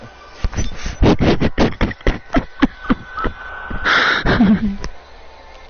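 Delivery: unhinged laughing to oneself; insane laugh. Acted. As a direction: laugh to yourself as your sanity goes. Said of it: "start laughing to yourself a little bit".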